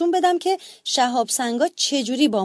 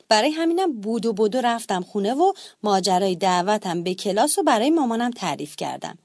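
ordinary delivery

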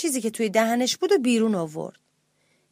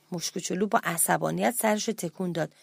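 ordinary delivery, nothing marked

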